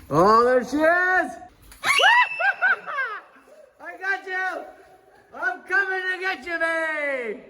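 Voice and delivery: high, teasing chase voice; playful monster chaser. Pretend. Playful chase game with child.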